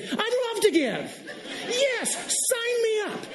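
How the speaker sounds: high, stretched-out eager yes; over-the-top cheerful sign-up; enthusiastic yes. Retold or imitated. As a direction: gush it like you can't wait to give. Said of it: "I'm just gonna say yes with enthusiasm".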